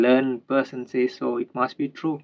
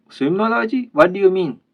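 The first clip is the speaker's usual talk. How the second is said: much higher, puzzled "Swimology?" thrown back; baffled surprise; 7 semitones higher, 5 dB louder than usual. Acted. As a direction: echo the odd word back, startled and high. Professor baffled by new word.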